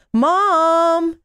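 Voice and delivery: put-on high, loud child calling Mom; a boy shouting with delight; excited yell. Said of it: "he yelled with excitement".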